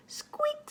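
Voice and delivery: high thin squeak like a swinging lantern; squeaky lantern. Acted. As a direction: squeak it out like an old rusty lantern.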